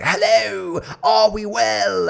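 very high, drawn-out British showman greeting; Russell Brand-like British. Acted. Comedian checking on his bar crowd, hammed-up British accent. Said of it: "fucking Russell Brand humped a tree".

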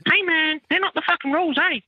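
very high falsetto retort; mock protest. Chef refusing the duty manager's dinner request, mock high-pitched protest. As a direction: squeal it as a hammed-up objection. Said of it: "And I was like".

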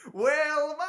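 high, loud shout of Wilma; John Candy yell. Retold or imitated. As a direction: yell the name with full joy. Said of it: "you just hear John Candy going".

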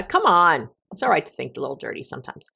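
usual speaking voice

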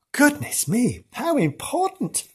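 high, hushed marvelling; awed amazement. Real, not acted. Marvelling at the person's importance.

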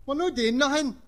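high, loud mock innocence; innocent protest. Lisa protesting innocence to the janitor, high and loud. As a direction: play dumb with the janitor.

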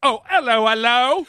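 loud, high British copper "hello, hello"; mock British bobby. Mocking the episode's British accent, loud and high. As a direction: ham up the English constable. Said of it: "straight from a Dickensian nightmare".

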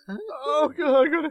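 high squealing giggles; laughing fit. Real, not acted. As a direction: laugh helplessly then try to stop.

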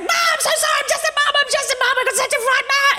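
high, fast, wailing apology through fake tears; a hammed-up crying mum begging the cop; mock sobbing plea. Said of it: "frightened by how much I'm crying".

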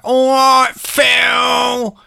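high, loud obnoxious shout. Retold or imitated. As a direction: yell the greeting like a horrible punk. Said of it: "he comes and goes".

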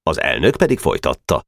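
plain reading voice

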